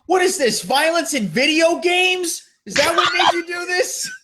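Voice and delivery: hammed-up angry dad; ranting dad. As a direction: blame the video games. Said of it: "Psycho Dad goes".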